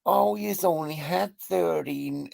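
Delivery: attempted American accent. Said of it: "I can't do a, an American accent".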